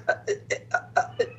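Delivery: halting, soft stammered syllables; imitated stuttering. Player stunned by his ranting opponent, mock stuttering. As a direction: act out being stuck for words. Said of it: "like, I was stuttering".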